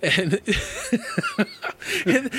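ordinary delivery

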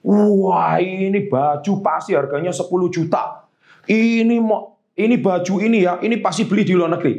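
hammed-up, breathless fawning over a shirt; tacky gushing compliment. Shown as a demonstration. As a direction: overdo the praise like a show-off flatterer. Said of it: "memuji yang norak itu seperti ini".

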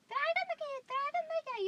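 high, loud shrill-free yell of orders; yelling mom. Retold or imitated. Yelling mom calling her kids to bring things, loud and high. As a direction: bark orders across the house. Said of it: "esa mamá gritona que por todo grita".

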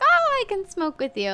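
high, loud eager imitation of herself; mock thrilled high voice; excited quote. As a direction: squeal it out, delighted. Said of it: "I was like".

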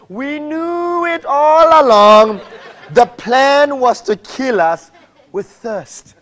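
hammed-up whine to Moses; mock whining. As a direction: stretch it out like a sulky moan. Voicing grumbling Israelites again.

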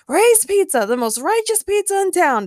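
declaring pitchman. A narrator's reading. Pizza man pitching his shop at the door, declaring. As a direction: proclaim it like an over-keen salesman. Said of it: "declared the man".

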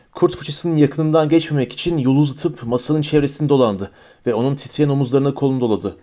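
ordinary delivery

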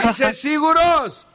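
calling out. Real, not acted. Host pressing the caller to reconsider his bomb, high drawn-out call. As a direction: stretch it out high as a hint. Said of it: "Αφού σε φώναζα".

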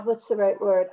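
ordinary talk

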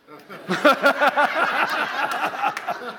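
high, loud burst of laughter; big laugh. Real, not acted. Preacher laughing at his tough-guy joke, high loud laughter.

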